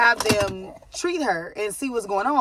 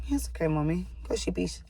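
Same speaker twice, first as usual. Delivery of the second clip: low, hushed reassurance; 3 semitones lower, 10 dB quieter than usual. Real, not acted. Mother turns from vlog to comfort her baby, soothing.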